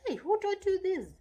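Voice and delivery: high, loud challenge; accusing question. Shown as a demonstration. Parent scaring a child with the question, accusing question. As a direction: call the child out, pitch jumping up. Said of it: "when you are just saying".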